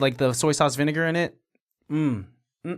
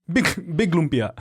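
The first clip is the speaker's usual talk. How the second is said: dumb caveman grunt-talk. Retold or imitated. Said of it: "In the caveman voice".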